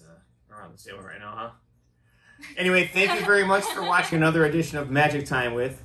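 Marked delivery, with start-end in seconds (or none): 2.6-5.8 loud, high TV host sign-off; show host outro. Acted. Closing the show.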